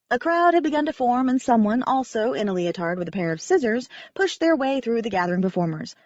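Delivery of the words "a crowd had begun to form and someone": plain reading voice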